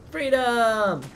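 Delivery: triumphant cry. Pretend. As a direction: call it out like a battle cry. Getting outside in the game.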